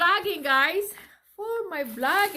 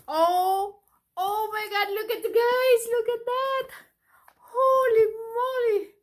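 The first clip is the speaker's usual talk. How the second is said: high and loud, near tears of joy; thrilled exclaiming; 10 semitones higher, 6 dB louder than usual. Real, not acted. Mother opening her son's present, high and tearful with joy. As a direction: gush at the gift, voice climbing.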